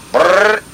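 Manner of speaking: high trilled burst; imitated machine gun. Veteran demonstrating enemy gun, short high trill. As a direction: roll out a rattle of gunfire. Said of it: "a Jap machine gun will go".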